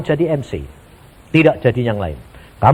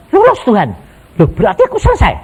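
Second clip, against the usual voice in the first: surprised; 7 semitones higher, 5 dB louder, faster than usual. Retold or imitated. Retold dialogue with God.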